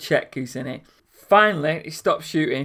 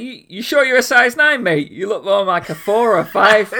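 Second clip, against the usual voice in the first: mock cheeky; 4 semitones higher, 7 dB louder than usual. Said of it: "you should just say something like".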